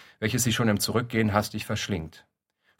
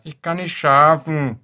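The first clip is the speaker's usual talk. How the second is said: put-on slow, higher and louder childish wail; bawling child; 6 semitones higher, 8 dB louder, slower than usual. Child stumbling into the kitchen, bawling. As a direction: whine it like a tired kid. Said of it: "plärrt".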